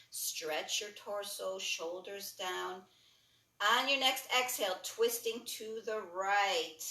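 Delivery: calm yoga instructor leading a class; guided yoga cueing. Acted. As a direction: talk them through each stretch gently. Guides a breathing yoga sequence.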